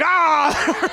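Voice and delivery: high, loud mock scream of a homeless woman chasing; imitated scream. Friend Stephanie chasing a dad, shrieking loud and high. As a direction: shriek like you're chasing someone off. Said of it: "chasing him with her tits out screaming".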